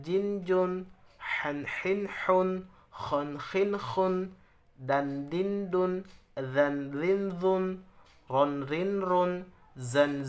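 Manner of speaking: each syllable short, pressed and quick; clipped drill of the syllables; short crisp syllables. Shown as a demonstration. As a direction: tap out each sound briskly. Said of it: "bacanya harus ditekan dan cepat dan pendek".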